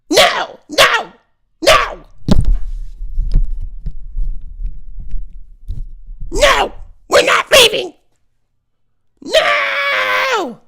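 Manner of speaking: very high mock child shriek; tantruming child. Seven-year-old defying a parent in public, shrieking tantrum. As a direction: screech it like a kid refusing to budge. Acting out child refusing to leave.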